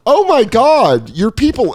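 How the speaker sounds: loud, high, drawn-out exclamation; gushing delight. Retold or imitated. Telling how he praised a stranger on the train, gushing. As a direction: gush slowly in exaggerated, high-pitched joy. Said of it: "that's how I said it".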